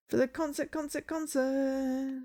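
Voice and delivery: high, drawn-out repeating echo; mock echo. Narrator echoes the word concert to close the scene, mock echo. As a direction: stretch it out like a fake echo.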